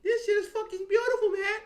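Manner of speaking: high hammed-up gushing wife; mock wife voice. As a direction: ham up a wife gushing over lights. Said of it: "She gonna be like".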